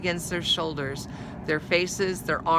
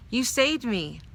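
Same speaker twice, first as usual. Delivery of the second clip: higher, bright take of "You saved me."; first try in the wrong voice before correcting; wrong character voice; 6 semitones higher than usual. Shown as a demonstration. Reader's misread take of Molly thanking dog, higher. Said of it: "Oh, I'm sorry, I'm Molly now".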